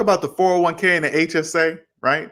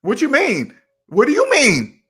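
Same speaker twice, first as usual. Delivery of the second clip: much higher, incredulous mock cry; baffled friend; 10 semitones higher than usual. Friend baffled by trusting math, high incredulous mock voice. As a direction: pitch the disbelief way up.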